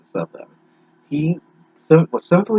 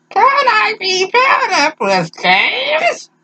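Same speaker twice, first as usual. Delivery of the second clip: put-on very high, slow sweet girl; squeaky drawn-out girl's plea; high girly voice; much slower than usual. Reading girl's line in voice.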